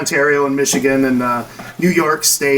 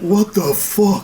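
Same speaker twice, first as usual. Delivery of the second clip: put-on Canadian accent; 4 semitones higher, much slower than usual. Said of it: "That, that".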